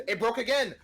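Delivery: loud, high exasperated yell; frustrated shout. Real, not acted. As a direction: yell at the broken stream. Stream keeps crashing.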